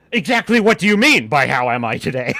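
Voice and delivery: hammed-up challenge to a barista; mock indignation. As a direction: demand an explanation in pretend offence.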